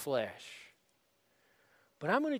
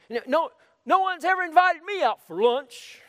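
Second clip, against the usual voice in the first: mock whine, much higher and louder; whiny complainer; 9 semitones higher, 8 dB louder than usual. Preacher mocking a church member who feels left out, whiny. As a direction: ham up a self-pitying member moaning.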